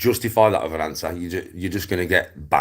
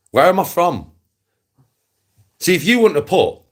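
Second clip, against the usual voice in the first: high and loud, scornful question; mocking indignation; 10 semitones higher, 8 dB louder than usual. Real, not acted. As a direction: jeer at him. Mocking rival's mistake.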